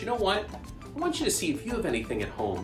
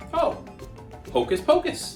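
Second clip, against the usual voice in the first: delighted; 13 semitones higher than usual. Acted. Pretending to hear kids.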